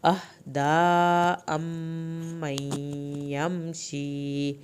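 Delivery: أَمَّنْ held one beat, يَمْشِي stretched; slow chanted phrase; held doubled meem. Shown as a demonstration. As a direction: linger on the doubled m. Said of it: "میم کی شد پہ ایک الف کے برابر دیر لگانی ہے".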